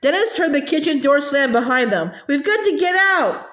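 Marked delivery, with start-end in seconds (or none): 2.3-3.5 yelled. A narrator's reading. Boy caught in the mist calls to escape, high alarmed call. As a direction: urge them out, alarmed. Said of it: "Sammy yelled".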